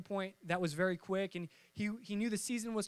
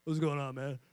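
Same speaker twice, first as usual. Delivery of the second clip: put-on a lowered, booming-ish teen voice; deep Thor voice; 7 semitones lower than usual. Friend after puberty greets him in a lowered voice. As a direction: put on a big grown-man voice. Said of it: "he got the voice of Thor".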